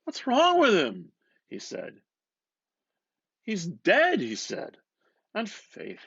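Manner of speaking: McCoy buttonholing Bloom, loud and eager; McCoy's voice. Acted. McCoy telling of Dignam's death.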